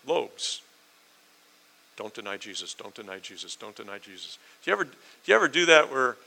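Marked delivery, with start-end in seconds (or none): 2.0-4.4 low, hushed repetition; muttered self-reminder. Acted. Peter reminding himself not to deny Jesus, muttered low. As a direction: repeat it softly to yourself.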